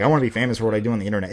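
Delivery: fast, mocking rattle; rattled-off mock quote. Mocking people who crave internet fame, fast rattle. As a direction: rush it out like a whiny wannabe. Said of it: "People were like".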